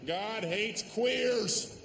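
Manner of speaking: slow, high, drawn-out protest chant; hammed-up bigot yelling a slogan. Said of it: "me pretending to be a right-wing redneck".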